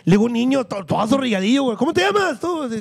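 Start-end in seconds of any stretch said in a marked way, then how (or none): 1.8-2.6 high put-on clown question to a timid kid; hungover clown voice. Clown asks a frightened child his name, high clown voice. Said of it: "porque yo los asustaba".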